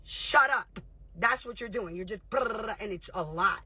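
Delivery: usual speaking voice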